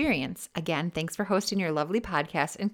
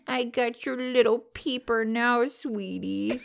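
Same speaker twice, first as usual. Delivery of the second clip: put-on baby talk; 5 semitones higher, slower than usual. Cooing to boyfriend.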